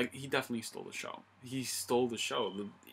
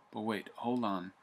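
usual speaking voice

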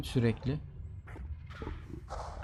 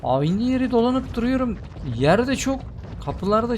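exasperated complaint; 10 semitones higher than usual. Real, not acted. Player ranting to viewers about the looping map, high fed-up complaint. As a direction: vent about going in circles.

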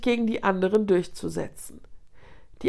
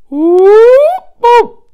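long, high, very loud hyena howl; eerie howl; 19 dB louder than usual. A narrator's reading. Hyena leader raising the alarm to her clan, eerie drawn-out howl. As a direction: howl long and piercingly to rally the pack. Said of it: "Ein schauriges, langgezogenes Heulen ertönte".

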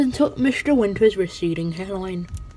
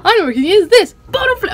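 very loud and high hype; excited shout; 10 semitones higher, 8 dB louder, faster than usual. Real, not acted. Gamer hyping a second bottle flip. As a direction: scream it like a big stunt.

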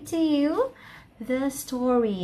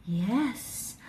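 ordinary delivery, nothing marked

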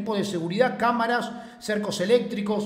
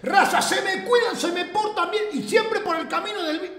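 laughing sign-off; 9 semitones higher than usual. Real, not acted. Playful sign-off to chat.

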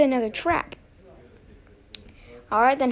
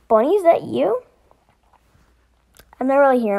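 ordinary talk, nothing marked

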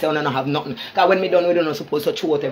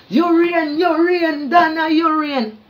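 much higher, drawn-out whining imitation; mocking wail; 12 semitones higher, 4 dB louder, much slower than usual. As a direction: whine it like a crying mock of Donna. Mocking Donna, high wailing imitation.

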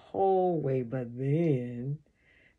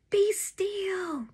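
very high-pitched urgent call; yelled command. A narrator's reading. Brother to child swarmed by bees, yelling. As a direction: cry out the order high and urgent. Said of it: "Ray just keeps yelling".

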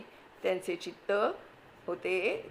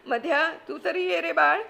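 high, loud, scolding housewife in a panic; frantic aunt; 8 semitones higher, 5 dB louder than usual. Acted. Wife panicking at husband as flood comes, frantic scolding. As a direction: snap at the husband while grabbing valuables. Kaku calls child.

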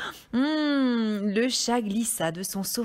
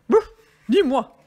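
loud woof, then short refusal; dog voice; 4 semitones higher, 10 dB louder than usual. A narrator's reading. Dog refusing to help the hen, loud barking voice. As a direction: bark it out like a dog. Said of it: "dit le chien".

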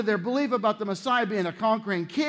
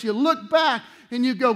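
usual speaking voice

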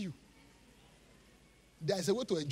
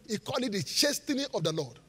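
ordinary talk

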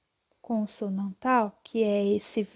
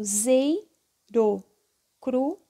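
"zei, ro, cru" as separate syllables with gaps; sílabas separadas; 3 dB louder, much slower than usual. Shown as a demonstration. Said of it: "Se eu coloco". ("zei, ro, cru" sílaba por sílaba, com pausas)

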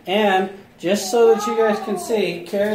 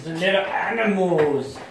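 normal talking voice

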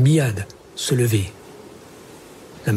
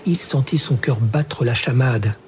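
plain reading voice

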